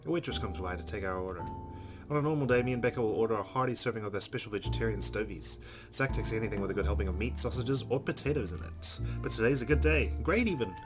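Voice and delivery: ordinary talk, nothing marked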